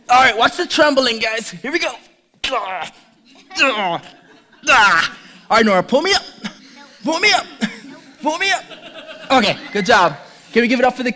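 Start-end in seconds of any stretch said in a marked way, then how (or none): 0.1-8.6 higher, louder strained calls for help; straining to lift, begging to be pulled up; straining under weight. Real, not acted. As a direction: push the words out while straining under a load. Push-ups with daughter on back.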